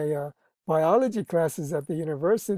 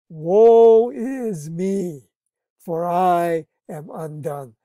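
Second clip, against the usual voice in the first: preaching; 6 dB louder, much slower than usual. Acted. Quoting Isaiah's lament.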